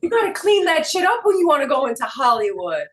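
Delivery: loud, high-pitched scolding; mock telling-off. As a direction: scold as if acting out a dressing-down. Acted telling-off over her accent.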